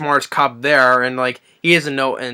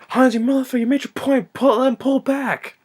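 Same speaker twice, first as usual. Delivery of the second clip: much higher strained shout; mock desperate yell; 12 semitones higher than usual. McClane yelling at Hans over the radio, high strained shout. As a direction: screech it like a desperate cop. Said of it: "And Bruce Willis like".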